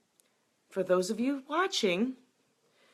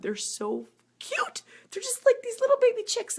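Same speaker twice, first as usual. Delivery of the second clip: gushing squeal. Real, not acted. Animal lover describes baby chicks, squealing high. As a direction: squeak it out, melting over cuteness.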